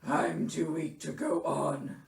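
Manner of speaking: low, self-pitying bear voice; feeble Bear. A narrator's reading. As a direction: give up as if fading. Bear claims to be fading.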